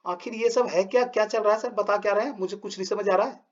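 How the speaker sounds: a hint of a baffled schoolkid; confused student. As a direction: play a lost pupil asking sir what is going on. Teacher voices confused student.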